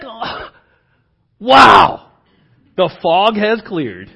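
a loud wow, then slow wonder; amazed realization. Retold or imitated. Asaph voiced seeing clearly at last, amazed. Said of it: "He's like".

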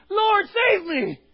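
high, loud, slow shout to the Lord; desperate shout. Acted. Preacher showing the church how to cry out, shouting. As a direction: cry out with no shame.

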